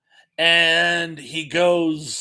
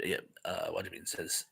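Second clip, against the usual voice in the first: ordinary talk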